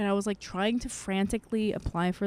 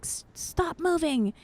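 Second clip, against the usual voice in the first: higher, slow imitation of a scolding parent; mock parent yell; 10 semitones higher, slower than usual. Recalling parents scolding her as a kid, a mild mock yell. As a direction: mimic a scolding parent, not a full shout. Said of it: "They'd be like".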